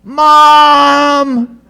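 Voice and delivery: long, high, loud wail for mom; imitated child's cry. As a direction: mimic a little sister crying out for her mother. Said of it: "'cause she cried out".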